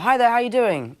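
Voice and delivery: lightly acted newcomer's greeting; imitated friendly greeting. Newcomer greeting people on Avengers, imitated hello.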